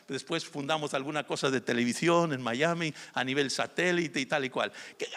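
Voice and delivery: normal talking voice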